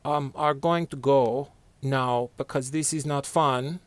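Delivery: a lightly put-on Norwegian accent. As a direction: Norwegian accent, mild and polite. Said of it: "the Norwegians sorta say".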